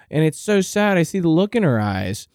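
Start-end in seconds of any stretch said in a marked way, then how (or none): none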